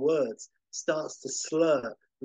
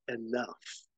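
low stern command; 3 semitones lower, 7 dB quieter than usual. Acted. Superman confronts the villain.

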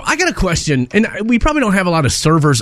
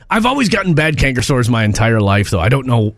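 normal talking voice

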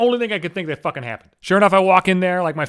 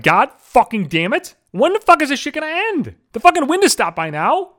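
retold scream; 8 semitones higher, 4 dB louder than usual. Alone at night after another outage, loud angry yell. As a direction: pitch jumping high, a loud frustrated yell. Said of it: "I fucking screamed".

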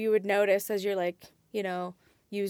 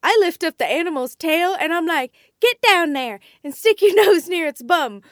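quoting; 7 semitones higher, 10 dB louder than usual. Retold or imitated. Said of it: "this is what she has to say".

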